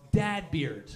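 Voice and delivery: normal talking voice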